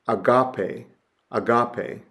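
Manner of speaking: "agape" stressed on the middle, a-GAH-pay; stressed accented syllable. Shown as a demonstration. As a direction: lean on GA in "agape". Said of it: "accent mark is placed over the stressed syllable".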